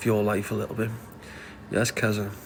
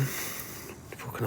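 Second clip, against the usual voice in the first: whisper; 11 dB quieter than usual. Real, not acted. Reacting to a sad comment.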